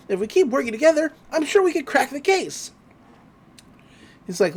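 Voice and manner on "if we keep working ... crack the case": high, fast, hopeful pitch; eager excitement. Retold or imitated. Dipper pleading to work with agents, acted eager excitement. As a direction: rush it out high like a keen kid.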